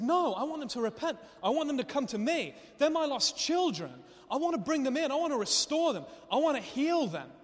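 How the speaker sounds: high, loud cry of longing; impassioned plea. Acted. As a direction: preach it as God pleading for lost children. God's impassioned plea, preached.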